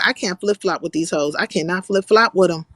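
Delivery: ordinary talk, nothing marked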